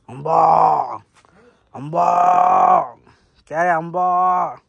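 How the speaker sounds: loud drawn-out call to a cow, slow at the end. Real, not acted. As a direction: call Amba out across the yard. Calling the cow to drink. (ఆవును గట్టిగా పిలవడం)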